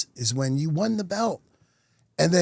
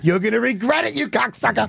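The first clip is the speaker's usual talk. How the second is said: higher-pitched retold threat, worked up; mock angry threat; 8 semitones higher than usual. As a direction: act out a heated challenge to a rival. Acts out his old angry reaction.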